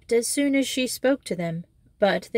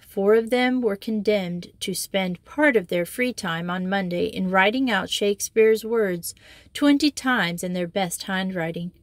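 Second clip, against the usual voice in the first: plain reading voice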